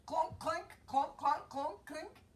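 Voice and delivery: mock metal clunks, playful; clanking armor sounds. Teller makes the armor noises for the children, playful clanks. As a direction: clank like heavy armor on a boy.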